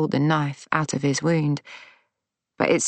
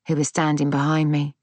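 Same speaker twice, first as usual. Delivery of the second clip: usual speaking voice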